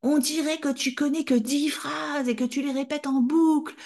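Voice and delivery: high, scornful shouting; child mocking the mother in a shout; angry shout. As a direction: sneer it at the top of the voice. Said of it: "Je criais".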